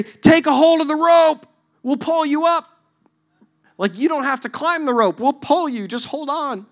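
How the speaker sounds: loud, high, urging shout; rescuers calling down. Acted. Rescuers to man in the well, loud high coaxing. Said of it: "they say".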